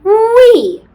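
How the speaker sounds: high, loud gleeful wee; playful wee. Shown as a demonstration. Teacher guiding kids' pencil dive, high gleeful wee. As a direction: swoop up like a slide.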